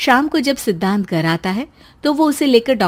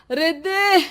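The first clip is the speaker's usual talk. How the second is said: much higher call to someone downstairs; 8 semitones higher, slower than usual. Acted. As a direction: shout her name from the roof. Calling wife from roof. (छत से पुकारना)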